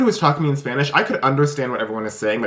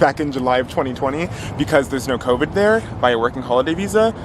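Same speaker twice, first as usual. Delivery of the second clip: normal talking voice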